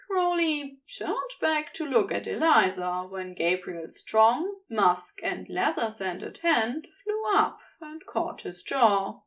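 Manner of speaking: ordinary delivery, nothing marked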